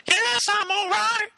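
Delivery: very high, auto-tuned robot voice; high robotic voice. Pretend. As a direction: squeak it out like a robot. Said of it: "you sound like a robot".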